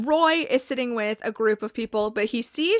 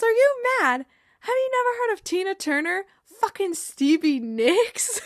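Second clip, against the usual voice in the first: indignant Jamie; 8 semitones higher than usual. Retold or imitated. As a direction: shriek Jamie's protest, much higher. Jamie protests to Roy.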